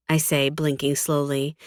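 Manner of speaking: ordinary delivery, nothing marked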